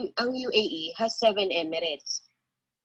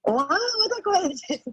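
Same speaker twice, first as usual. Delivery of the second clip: amazed, pitch leaping up high; pleasantly surprised; 9 semitones higher than usual. Real, not acted. Student hears professor's friend also married an Indian national, surprised. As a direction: react with real surprise at a coincidence.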